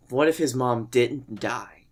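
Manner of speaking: normal talking voice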